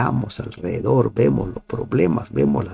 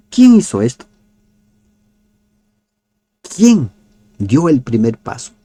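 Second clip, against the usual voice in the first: louder and higher, pressing the question; emphatic challenge; 6 semitones higher, 7 dB louder than usual. Acted. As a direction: demand an answer from the room. Insiste con fuerza. (pregunta enfática y más fuerte)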